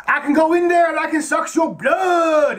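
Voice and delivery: high, slow mock vampire shout; a silly pretend vampire boasting; hammed-up vampire. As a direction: play the vampire threat over the top. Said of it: "he's like".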